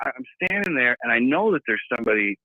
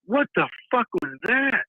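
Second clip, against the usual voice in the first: higher, alarmed retold question; shocked; 8 semitones higher than usual. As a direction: demand it, rattled. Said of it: "I said".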